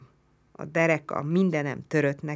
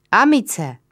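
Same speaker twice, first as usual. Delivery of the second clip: loud, higher call into the dark; 6 semitones higher, 9 dB louder than usual. A narrator's reading. Narrator calling out loud to the Roman. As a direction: shout to reach him across the field. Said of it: "kiáltottam reá".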